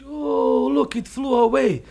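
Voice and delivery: high, drawn-out mock amazement; hammed-up high-pitched wonder; feigned surprise. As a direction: pretend to marvel as it escapes. Rabbit fakes butterfly escaping.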